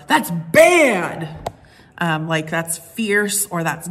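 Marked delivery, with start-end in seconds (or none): 0.1-1.5 louder, higher playful slang; teasing praise. Acted. Preacher showing bad as a compliment, playful teasing. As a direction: toss it off as admiring slang.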